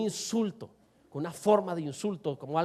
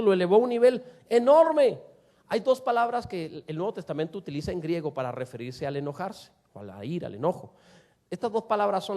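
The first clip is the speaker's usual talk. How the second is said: ordinary delivery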